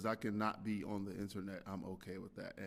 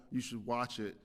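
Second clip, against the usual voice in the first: ordinary delivery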